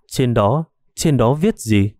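ordinary delivery

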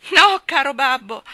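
high, loud, slow imploring cry; pleading cry. A narrator's reading. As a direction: beg him desperately to stop. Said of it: "gridò Maggie con voce supplichevole".

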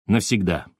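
plain reading voice

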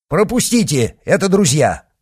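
high, loud command; shouted order. A narrator's reading. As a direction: call out to your men to stand aside. Ordering his men to let pass.

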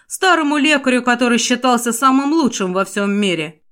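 ordinary delivery

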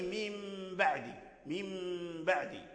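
"من بعد" as mim ba'd with a long nasal hum; ghunnah demo. Shown as a demonstration. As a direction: let the hum ring in "من" before "بعد". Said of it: "هذه هي الغنة في".

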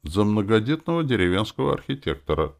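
careless. A narrator's reading. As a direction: toss it out casually. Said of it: "сказал я небрежно". (небрежно, как бы между прочим)

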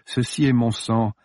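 ordinary delivery, nothing marked

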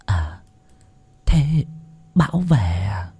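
put-on soft old woman slowly catching on; dawning "ah". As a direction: murmur it back as a quiet, slow question. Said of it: "đoạn ả lên một tiếng".

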